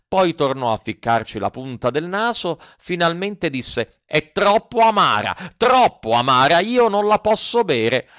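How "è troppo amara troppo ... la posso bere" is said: put-on high, loud childish complaint; whining Pinocchio. Puppet refuses the fairy's bitter medicine, whining. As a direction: whine it high, dragging out the end.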